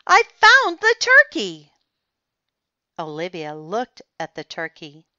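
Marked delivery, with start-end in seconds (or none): 0.1-1.7 high, loud triumphant yell. A narrator's reading. Claude announces his turkey to everyone, yelling. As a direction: shout out the big find at full voice. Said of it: "Claude was yelling".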